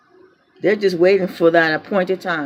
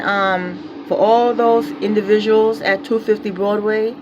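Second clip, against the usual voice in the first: normal talking voice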